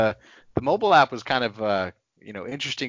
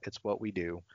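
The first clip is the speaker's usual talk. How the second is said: whispered aside; 3 semitones lower, 10 dB quieter than usual. Real, not acted. Playful aside after plug.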